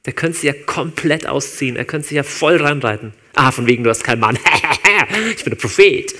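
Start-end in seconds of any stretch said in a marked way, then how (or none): 3.3-6.1 louder, laughing mock triumph; mock gloating. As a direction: play a smug know-it-all, half laughing. Hypothetical gloating Jesus mocking woman. (spöttisch auftrumpfend mit Lachen)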